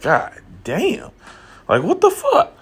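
high-pitched disbelieving mutter breaking into a laugh; baffled inner voice. Retold or imitated. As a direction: act out your own confused thoughts, half laughing. Inner thoughts acted out, confused.

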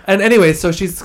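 usual speaking voice

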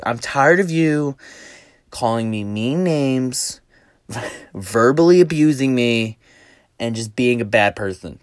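slow, drawn-out mock complaint; playing the victim. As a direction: imitate a wronged woman laying on the self-pity. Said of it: "playing the victim role really well".

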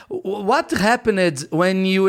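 slow, halting attempt at English; hesitant, dragged-out English mistake; tense, fumbled English. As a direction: stumble slowly through the English question. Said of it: "eu erro no inglês, porque eu tava tenso".

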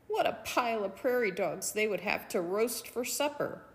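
ordinary delivery, nothing marked

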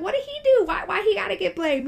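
high-pitched, loud mock disbelief; incredulous protest. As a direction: protest in baffled, squeaky indignation. Her own baffled reaction to blame.

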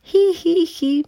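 high, thin acted whimpers; disconsolate whimper. A narrator's reading. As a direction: whimper like a small animal crying. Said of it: "un gemido desconsolador".

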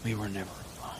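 low, very soft, hushed voice; quiet, tender creature. Acted. Ueg comforting Janice while holding their hand, low and hushed. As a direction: say it low and intimate, barely voiced. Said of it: "and he goes".

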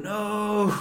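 mock anguished "No!", high and loud; imitating a cartoonish wail of despair; anguished cry. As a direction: ham up a cat's cry of grief. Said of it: "on his knees crying, going".

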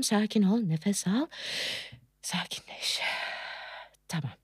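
soft, sinking into a whisper; self-soothing. Acted. Woman telling herself to breathe, hushed and calming. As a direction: let it fade to a breathed whisper.

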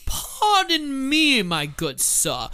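slow, prim, overly polite gentleman; mock posh gentleman. Co-host voicing a fancy frog, slow and prim. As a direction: draw it out like a stuffy aristocrat.

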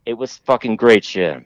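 usual speaking voice

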